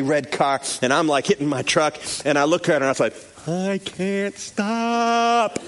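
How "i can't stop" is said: drawn-out, higher slow-motion yell. Retold or imitated. Driver to oncoming girl as truck slides, slow-motion cry. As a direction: stretch every word as if in slow motion. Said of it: "Everything goes in slow motion".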